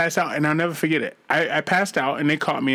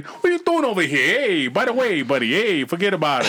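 put-on Italian accent; 2 semitones higher than usual. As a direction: do a hammed-up Italian wiseguy accent. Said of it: "You hear me talk like an Italian".

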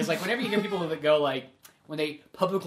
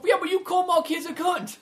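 much higher offended retort; mock indignant; 12 semitones higher than usual. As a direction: imitate an aggrieved father in a quarrel. Acted quarrel from film.

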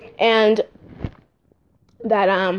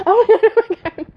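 panicked; 12 semitones higher, 3 dB louder, faster than usual. Retold or imitated. Louder replay of sister's panic.

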